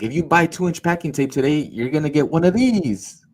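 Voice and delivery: put-on sales patter; telemarketer pitch. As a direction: dangle the free gift to a customer. Acting out his telemarketing pitch.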